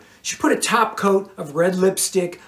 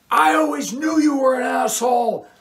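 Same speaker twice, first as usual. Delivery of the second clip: high screaming rage; scorned woman screaming at her ex in a bar; furious shout; 8 semitones higher than usual. A narrator's reading. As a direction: shriek it at him across the table. Cheryl yells at Doug.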